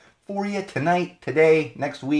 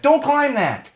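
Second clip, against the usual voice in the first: sharp, much higher, louder parental shout; parent warning toddler; 7 dB louder, slower than usual. Retold or imitated. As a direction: yell it at a toddler climbing furniture. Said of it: "all day there's a lot of".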